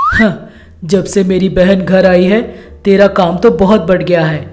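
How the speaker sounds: husband talking to his wife; husband, considerate. Acted. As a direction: propose a solution in a low pitch. Voices the husband.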